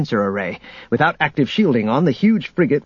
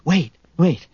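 higher, urgent call; calling after someone; 5 semitones higher than usual. A narrator's reading. Leia running after Chewie, urgent call to wait. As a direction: call out to stop him.

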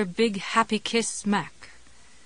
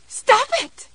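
much higher, louder shriek; 8 dB louder than usual. Acted. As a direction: scream it in horror. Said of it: "Jessie shrieked".

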